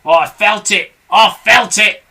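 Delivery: loud indignant repeated cry; Mr Twit shouting. A narrator's reading. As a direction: shout it in disgust. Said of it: "shouted Mister Twit".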